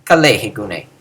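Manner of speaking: low, level "Kalehe gune"; same low pitch. Shown as a demonstration. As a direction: let "Kalehe gune" settle low as one phrase. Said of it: "They have that same pitch".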